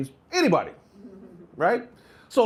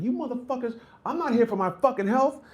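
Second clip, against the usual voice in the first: higher, mock-furious rant; coach yelling at team; 9 semitones higher than usual. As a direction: chew out the lazy runners.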